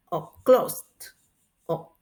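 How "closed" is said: "closed" in one syllable, ending "st"; candidate T ending. Shown as a demonstration. Teacher reviews the options for students, "closed" as one syllable with T. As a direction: release a T at the end of "closed". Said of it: "cómo se pronuncia el pasado".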